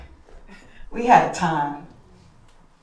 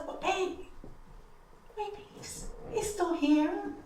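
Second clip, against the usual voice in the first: gentle reassurance; 8 semitones higher, 12 dB quieter than usual. Retold or imitated. Husband gently reassures crying wife.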